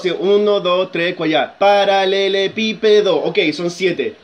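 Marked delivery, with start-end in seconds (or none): 1.6-3.2 "Paralelepípedo" slowed into separate syllables; measured beats through "Paralelepípedo". Shown as a demonstration. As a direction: count "Paralelepípedo" out on the fingers. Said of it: "yo cuento con los dedos".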